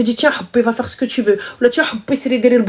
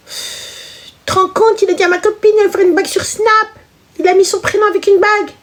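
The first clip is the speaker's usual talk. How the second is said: mock high voice; 9 semitones higher than usual.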